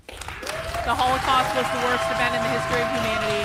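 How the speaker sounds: loud, booming announcement; hammed-up proclamation, loud and booming; mock solemn boom. Shown as a demonstration. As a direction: overplay it like a grand proclamation. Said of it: "We are not making fun of the Holocaust".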